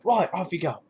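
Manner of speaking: mock sergeant major scoffing. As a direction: sneer at the recruit like a sergeant major. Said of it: "Sergeant Major".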